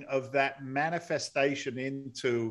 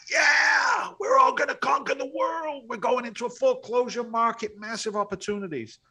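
hyped-up rallying cry; 11 semitones higher than usual. Retold or imitated. Mimicking himself among sixty new seminar students, high excited shouting. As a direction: yell it like a fired-up convert.

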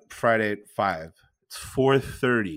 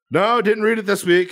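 embarrassed quick denial; 8 semitones higher, 5 dB louder than usual. Retold or imitated. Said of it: "He's just like".

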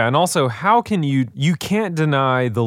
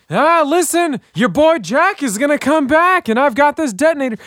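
mock villain voice; 5 dB louder than usual. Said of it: "he's like".

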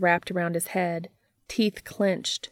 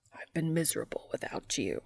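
fast broken whisper; 2 semitones lower, 8 dB quieter, faster than usual. Acted. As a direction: whisper it through tears. Tearful whisper to Cass.

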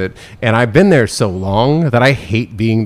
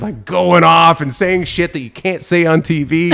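ordinary talk, nothing marked

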